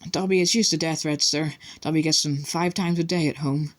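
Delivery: high elf voice with a weak smile; weakly smiling elf. A narrator's reading. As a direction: shrug off the threats meekly. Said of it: "Dobby smiled weakly".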